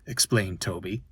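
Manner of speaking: usual speaking voice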